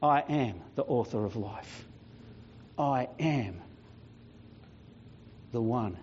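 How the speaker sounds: low, soft, with long weighty pauses; solemn declaration. Acted. Jesus tells the devil his power is broken, solemn declaration. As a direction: pronounce it with quiet, final authority. Reads Jesus's letter to Death.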